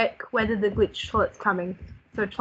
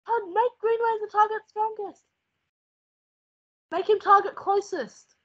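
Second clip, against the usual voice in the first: urgent high orders; 9 semitones higher than usual. Real, not acted. Urgent orders during boss.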